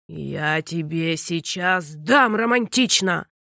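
low, slow furious threat; roaring father. A narrator's reading. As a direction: stress дам like a threat. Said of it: "взревел отец". (низко и медленно, грозно ревя на «дам»)

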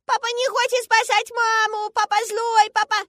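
very high, loud, slow drawn-out wail; child screaming. A narrator's reading. Girl accusing father of not saving mother, screaming. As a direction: howl it like a small girl in a tantrum. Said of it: "действительно начинает кричать".

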